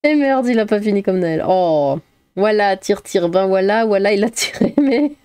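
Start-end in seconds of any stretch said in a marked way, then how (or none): none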